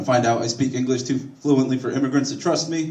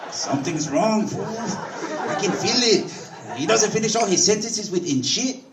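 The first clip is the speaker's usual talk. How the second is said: suspicious cousin; 9 semitones higher than usual. Retold or imitated. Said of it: "my cousin".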